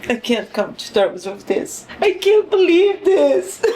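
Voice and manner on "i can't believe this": high crying protest; distraught, high-pitched outburst; tearful disbelief. Real, not acted. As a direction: wail it, refusing to accept it. Cannot accept character's death.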